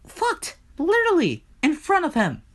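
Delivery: very high, incredulous outburst; scandalized exclamation. Acted. Storyteller on what Hades just saw, high scandalized outburst. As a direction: squeak out each piece in disbelief.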